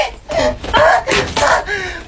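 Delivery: high cry of exasperation breaking out; shrill frustrated wail. Acted. Fed up with the blocked path, a shrill exasperated wail. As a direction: let a frustrated squeal burst out. Frustrated outburst before shouting.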